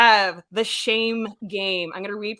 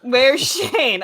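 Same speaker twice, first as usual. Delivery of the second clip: laughing repeat; 3 semitones higher, 7 dB louder than usual. Retold or imitated. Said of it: "your noise".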